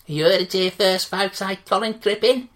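booming sports reporter wrap-up; brash TalkSport reporter signing off; loud radio sign-off. Acted. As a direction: sign off the piece like a radio hack. Interviewer signs off on air.

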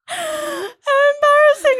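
very high, loud squeal of laughter; laughing too hard to talk; cracking up. Real, not acted. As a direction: squeeze the words out slowly through helpless laughter. Cracks up reading the reply.

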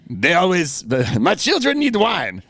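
a hint of a Simpsons French character; French-accented quote. Husband quoting The Simpsons to his wife, light French accent. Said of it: "that's from The Simpsons".

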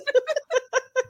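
high-pitched laughter; real laugh. Real, not acted. Friend laughing at her own slip, high laugh.

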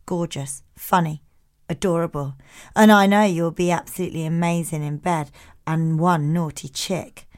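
low, soft, lightly put-on accent; Keith accent. Keith texting compliments to Jemima, in a lightly put-on Keith accent. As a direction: read the texts in a low-key accent. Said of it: "Do it in a Keith accent".

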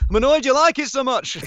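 hammed-up mock annoyance; mock annoyed. Co-star teasing him after the interview, hammed-up mock annoyance. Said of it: "he was like".

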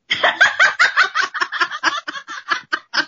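long shrieking laugh; real loud cackle; cracking up at the stack of singles; high burst of laughter. Real, not acted.

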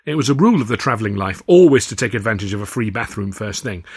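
ordinary delivery

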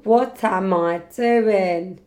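slow, stretched self-questioning; drawn-out. Real, not acted. Repeating the question to herself, slow and drawn-out.

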